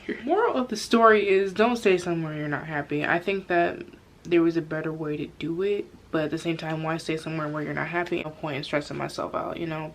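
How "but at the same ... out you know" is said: normal talking voice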